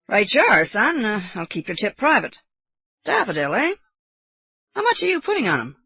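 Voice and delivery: lightly played uncle, lively intonation; lightly voiced genial uncle. Uncle humouring his young nephew, lightly genial. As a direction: humour the boy, a hint of amused uncle.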